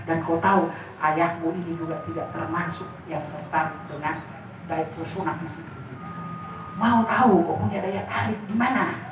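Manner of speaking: a hint of a low, soft father's voice. Father calmly corrects his daughter, lightly voiced low and soft. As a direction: gently correct her like a wise father. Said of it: "permainan suara ayah saya".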